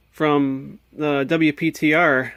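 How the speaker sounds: normal talking voice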